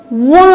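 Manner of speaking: व with the vowel hummed through the nose; nasalized vowel. Shown as a demonstration. Teacher showing children chandrabindu, nasalized व. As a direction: draw out व as a nasal वँ. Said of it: "व पर लगाएंगे तो".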